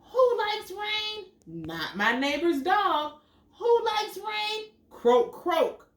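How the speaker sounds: lively storybook read, big high questions; animated picture book reading; reading to children. Acted. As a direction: read it out to little kids with wonder. Reads picture book aloud.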